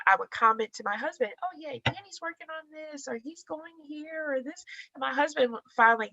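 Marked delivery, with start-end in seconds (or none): 1.4-4.6 airy gushing. Retold or imitated. Gushing about Danny to husband.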